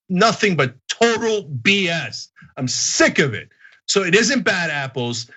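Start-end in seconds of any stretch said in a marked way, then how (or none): none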